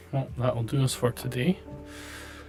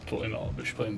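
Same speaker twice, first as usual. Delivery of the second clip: much lower, mock official denial; deep mock voice; 12 semitones lower, faster than usual. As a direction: clear the throat and drop deep, deadpan. Throat clear, then joke voice.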